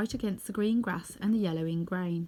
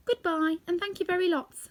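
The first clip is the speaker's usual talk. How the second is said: put-on much higher, grateful girl; 9 semitones higher than usual. A narrator's reading. Dorothy thanks the departing monkeys: high childlike. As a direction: thank them brightly like a child. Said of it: "backed the lady".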